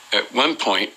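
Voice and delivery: usual speaking voice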